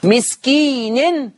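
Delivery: مسكين slowly recited ending in -nin with kasra and tanween; drawn-out مسكين with final nun in i; Hafs reading. Shown as a demonstration. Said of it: "كسر النون مسكين".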